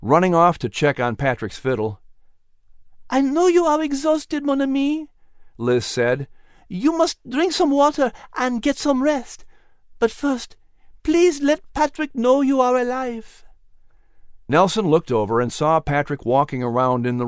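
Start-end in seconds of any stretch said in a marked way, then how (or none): 3.1-13.5 high, tender female voice with French flair; caring Liz. Acted. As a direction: gently urge him to rest. Liz tends Nelson.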